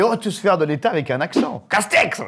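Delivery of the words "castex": shouted name. Real, not acted. Friends shouting Castex's name excitedly. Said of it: "calling out Castex".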